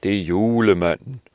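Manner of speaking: loud, booming Santa announcement; proud announcement. Acted. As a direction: proclaim it like Santa arriving. Nikolaj announces himself as Santa.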